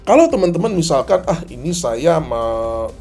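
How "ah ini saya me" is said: ordinary talk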